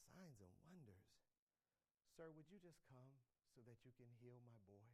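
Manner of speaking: soft, low begging father; humble, hushed plea to Jesus; groveling plea. Acted. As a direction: grovel quietly for the boy. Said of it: "Can I get you to grovel with that?".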